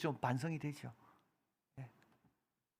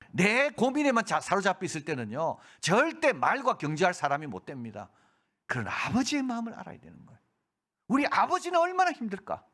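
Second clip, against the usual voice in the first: preaching at full force; 9 semitones higher, 6 dB louder than usual. Acted. Preaching climax.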